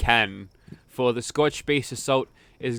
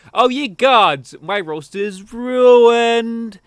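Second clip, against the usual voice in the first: drawn-out wail, higher and louder; hammed-up Skinner despair over the roast; slow, high, louder mock cry of dismay; mock panic; 7 semitones higher, 8 dB louder, slower than usual.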